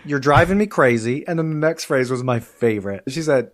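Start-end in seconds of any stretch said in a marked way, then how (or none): 0.0-1.2 higher, louder mock-exasperated teacher; imitated exasperation. Teacher to a persistent student, exasperated. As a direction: throw up your hands, playfully fed up. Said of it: "she'd finally just get exasperated".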